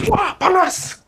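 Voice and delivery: sudden high yelp of pain; high startled cry of "hot!"; retold yell. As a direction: yelp like burnt fingers. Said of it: "Terus dia teriak".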